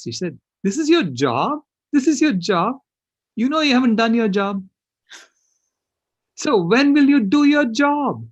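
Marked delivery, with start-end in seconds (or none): 0.6-1.6 higher, louder astonished question; imitating surprised child. Granddaughter asking grandpa about his job, surprised and louder. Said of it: "looked at me wide-eyed in surprise". 1.9-2.8 much higher and louder repeat of the question; imitating shocked child. Granddaughter repeating to grandpa in disbelief, much higher and louder. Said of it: "looked at me wide-eyed in surprise". 3.4-8.3 imitating indignant child. Granddaughter demanding grandpa do his job, high and loud.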